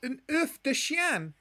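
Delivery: squeaky dim student voice; high silly voice. Acted. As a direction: pipe it up high like a clueless kid.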